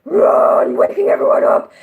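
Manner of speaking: full-voiced waking scream; loud scream. Shown as a demonstration. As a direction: shout it out loud. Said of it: "instead of me going".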